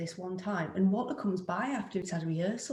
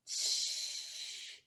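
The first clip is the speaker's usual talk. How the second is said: long soft "shh"; dismissive shushing hiss; 9 dB quieter than usual. Acted. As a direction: let out a drawn-out hushing breath. Scoffs before correcting herself.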